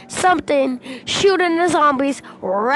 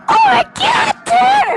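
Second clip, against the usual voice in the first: shrill top-of-lungs squeal, much higher and louder; excited scream; 7 dB louder than usual. Retold or imitated. Cousin screaming at the gun menu, ecstatic. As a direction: scream it like you just saw treasure. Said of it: "he was like".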